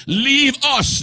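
high cry of the possessed man; demon crying out. Acted. Possessed man crying out at Jesus in the synagogue, high. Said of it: "he cried out".

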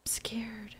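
tiny frightened whisper, almost no voice; scared whisper. Real, not acted. Player hiding from scorpions, whispering she is scared. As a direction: breathe it out in fear.

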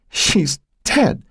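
high-pitched grieving cry; wailed. A narrator's reading. Mother sure her daughter is dead, high wail. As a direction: wail it in anguish. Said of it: "she'd wailed".

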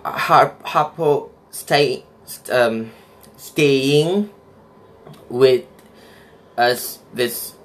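slow halting read in a Malay accent; careful old-accent read, stumbling on words. Shown as a demonstration. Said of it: "I had to say in my old accent".

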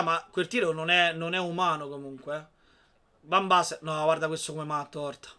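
ordinary delivery

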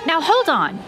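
loud, high-pitched interruption; sudden objection. Acted. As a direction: cut in sharply to halt the conversation. Abruptly objects, halting the conversation.

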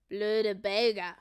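slow, loud grumble; grumbled insult. A narrator's reading. Leo about the children, slow sour grumble. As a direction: grumble it sourly, drawing it out. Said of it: "murmelte Leo".

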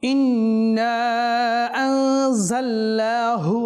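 slow melodic chant with drawn-out vowels; slow Quran recitation. Shown as a demonstration. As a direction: recite the verse slowly in tajweed. (ধীর সুরেলা তিলাওয়াত)

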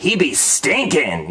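"stinking" drawn out as stin-Ken; slow, slangy "He be stinking"; slangy pun delivery. Shown as a demonstration. Showing how the pun passes as a word, slangy drawn-out "stinking". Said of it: "Unless you say it like".

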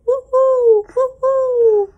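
high hooting woo-hoo; owl hoot. Pretend. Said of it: "He can sound like an owl".

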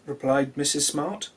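plain reading voice

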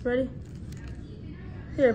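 usual speaking voice

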